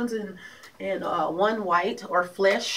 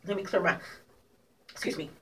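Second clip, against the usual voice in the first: ordinary talk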